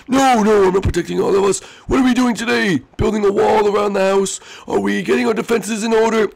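high, half-sorry little voice; high squeaky character voice. Acted. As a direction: apologise in a squeaky voice.

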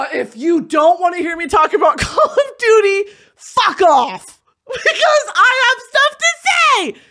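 loud, high rant rising to a shriek; mock-furious yelling. Host telling off Call of Duty objectors, shrieking. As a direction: build to a shrieked declaration.

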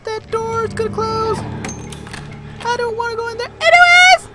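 escalating panic, ending in a yelp; panicked rising voice. Real, not acted. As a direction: voice climbing high in fear. Scared player narrating in panic.

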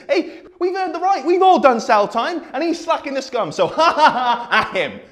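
high, loud mock cheering; mocking jeer. Speaker mimicking the jeering crowd, mock high-pitched glee. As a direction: jeer with a forced ha-ha-ha.

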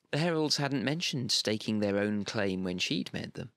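ordinary delivery

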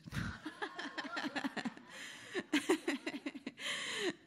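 light high giggle after a joke. Real, not acted. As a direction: laugh at your own joke. Laughing at her own joke.

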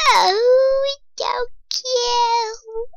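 very high, drawn-out coo; high-pitched wailing gush; cute overload. Real, not acted. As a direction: squeal slowly over something adorable. Gushing over the baby chicken.

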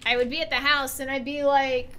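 normal talking voice

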